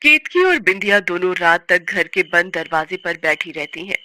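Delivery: ordinary delivery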